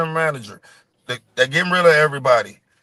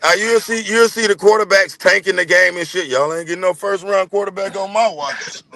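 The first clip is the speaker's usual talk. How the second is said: ordinary delivery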